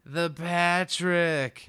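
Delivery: high, loud, drawn-out lament; hammed-up outburst. Suspect bursts out listing his misdeeds, hammed-up outburst. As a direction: stretch each item into an imitated wail.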